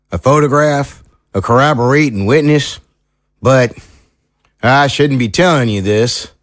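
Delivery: country lawman, hesitant then frank; folksy small-town sheriff, confiding. Acted. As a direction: play a sheriff sharing what he shouldn't. Sheriff figure confides a plan.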